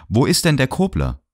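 high, mocking question; ironic. A narrator's reading. Third gentleman needling Anna about Kobler, ironic. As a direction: ask with needling irony. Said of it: "fragte der Dritte ironisch".

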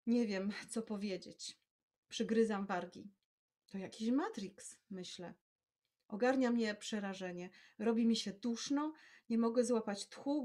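measured storytelling read aloud; calm literary reading voice; story narration. A narrator's reading. Reads her story aloud.

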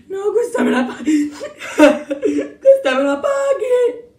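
broken, sobbing threat; tearful vow of revenge. Acted. Victim vows James will pay, broken tearful voice.

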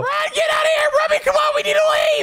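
high, fast panicked shout; urging friends to flee; panicked yell. Acted. Fleeing arrest in game.

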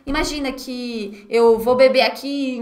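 ordinary talk, nothing marked

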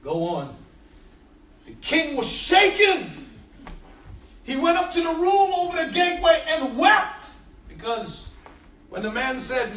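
low, measured Bible reading; solemn reading of the story of Absalom; scripture reading. A narrator's reading. Reads scripture passage aloud.

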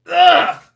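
high, loud gagging ugh; loud disgusted ugh. Real, not acted. Reacting to yucky punch with loud disgusted ugh. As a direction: recoil in disgust.

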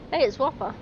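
ordinary talk, nothing marked

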